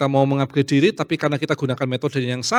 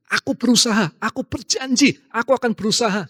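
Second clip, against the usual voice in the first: mock pious promise; 7 semitones higher than usual. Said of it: "terdengarnya bagus kan".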